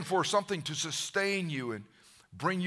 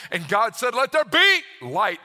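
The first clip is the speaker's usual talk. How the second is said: booming command; 5 semitones higher, 9 dB louder than usual. Acted. God commanding light into being, booming. God's creative command proclaimed.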